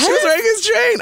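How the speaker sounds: very high-pitched squealed gossip; shrieky, delighted confirmation; thrilled insistence. Real, not acted. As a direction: squeal the scandal out. Repeats the reveal to amazed host.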